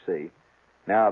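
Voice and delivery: ordinary talk, nothing marked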